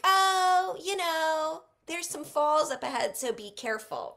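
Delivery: high, loud, breezy mock-casual call; playfully imitating a jokey heads-up; joking warning. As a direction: toss off the warning as an obvious joke. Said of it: "totally jokingly".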